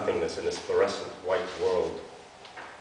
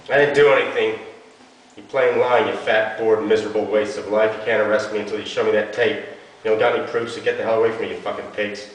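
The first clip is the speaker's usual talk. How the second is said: loud furious defiance; a cornered girl cursing at police, enraged; angry outburst; 2 semitones higher, 6 dB louder than usual. Acted. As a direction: spit the insults at them. She rages at the police.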